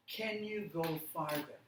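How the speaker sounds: ordinary delivery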